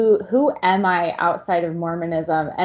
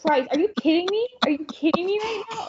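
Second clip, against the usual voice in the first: mock disbelief; 11 semitones higher, 4 dB quieter than usual. Continuing mock parental outrage.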